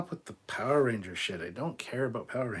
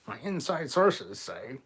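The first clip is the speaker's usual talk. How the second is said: slow, higher mock self-important claim; 4 semitones higher, 5 dB louder, slower than usual. Host imitating clickbait rumour-mongers, mocking. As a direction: drag it out in a mocking put-on voice.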